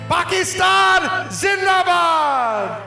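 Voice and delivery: shouting. Real, not acted. Performer leads patriotic chant.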